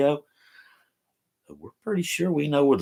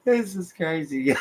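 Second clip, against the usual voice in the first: laughing; 5 semitones higher than usual. Real, not acted. Laughing fit on a livestream.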